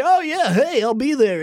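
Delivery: high mocking Denny voice, breezy fake promise; Denny impression. Host mocks the no-show DJ in a high mock voice. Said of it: "That was a pretty good Denny, too".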